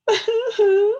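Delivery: high boo-hoo wail echoed back; echoing mock crying. A narrator's reading. The echo across the pond, a high mock wail. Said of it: "said the voice from across the pond".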